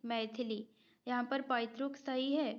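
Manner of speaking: usual speaking voice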